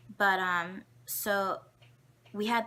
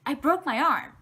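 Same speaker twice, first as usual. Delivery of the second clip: louder, higher distressed wail; mock-wail the bad news; crying out; 7 semitones higher, 9 dB louder than usual. Crying second grader tells everyone she broke her arm, louder and higher. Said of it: "And I'm, like, crying".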